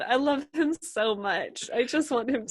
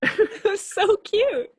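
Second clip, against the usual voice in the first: squealing with laughter, high; laughing through the words; 12 semitones higher, 5 dB louder, slower than usual. Real, not acted. Laughing at friend's accent.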